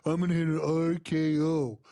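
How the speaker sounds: lightly voiced mock Randy Orton brag. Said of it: "'cause I'm Randy Orton".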